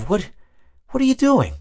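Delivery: sudden high alarmed squeal; startled squeak. Retold or imitated. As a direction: yelp it in shocked disbelief. Draped with picnic cloth, startled.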